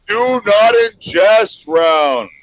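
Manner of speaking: slow, booming game-show title call; announcer title. Acted. Announcing the next segment to the audience, booming.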